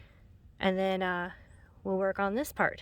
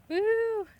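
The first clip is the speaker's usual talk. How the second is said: excited whoop; 13 semitones higher than usual. Real, not acted. Burning off creosote with torch.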